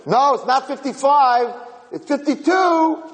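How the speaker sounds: high, slow squabbling voice; arguing old porch-sitter. Retold or imitated. Old porch-sitter correcting another's bus count, high squabbling mock voice. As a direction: contradict in a high, drawn-out put-on voice. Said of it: "they're all fighting there".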